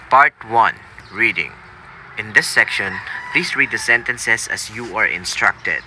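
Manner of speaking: measured, formal exam-prompt reading, a bit slow; test examiner read. Acted. Coach reading test prompts to applicants, formal examiner voice. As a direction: read it like a recorded exam instruction.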